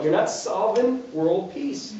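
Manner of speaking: ordinary delivery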